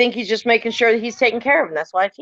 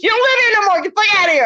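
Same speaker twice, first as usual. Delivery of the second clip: higher, half-laughing retold yell at the ghost; daughter yelling at ghost; 9 semitones higher, faster than usual. Mother retells daughter ordering the ghost out, mock yell. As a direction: imitate the daughter shouting, a bit amused. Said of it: "She goes".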